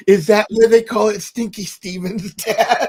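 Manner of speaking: put-on high, loud innocent kid question; little girl voice. Daughter asking her dad, high childlike voice. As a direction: ask like a curious small daughter.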